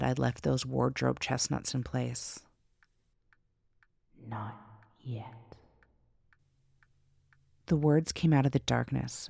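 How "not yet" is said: hissed, furious whisper; angry whisper. Acted. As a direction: whisper it with barely held rage. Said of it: "an angry whisper".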